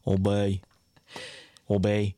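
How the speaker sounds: put-on "Old Bay" slurred together as "obey". Shown as a demonstration. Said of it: "like they're saying obey".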